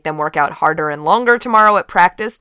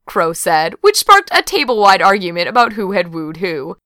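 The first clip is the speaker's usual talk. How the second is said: ordinary delivery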